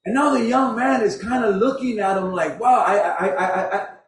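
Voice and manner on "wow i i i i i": stumped stammer. Acted. Young man stumped by the question.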